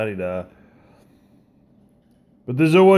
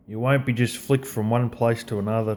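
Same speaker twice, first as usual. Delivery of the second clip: ordinary talk, nothing marked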